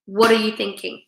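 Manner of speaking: ordinary delivery, nothing marked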